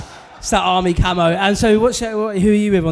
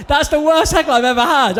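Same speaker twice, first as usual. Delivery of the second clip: normal talking voice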